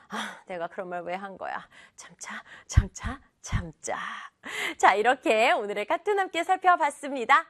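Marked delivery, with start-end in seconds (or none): 0.1-4.0 whisper. Acted. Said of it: "그랬더니 크리스틴이요".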